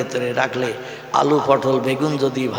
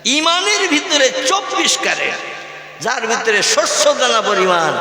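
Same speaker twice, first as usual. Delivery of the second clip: preaching at full force; 7 semitones higher than usual. Acted. Preacher emphatic high call.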